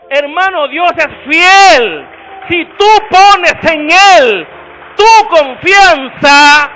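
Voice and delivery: loud, slow, drawn-out preaching to the congregation; full-force preaching. Real, not acted. Preacher to the congregation, shouting slow and loud. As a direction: proclaim it to the whole church.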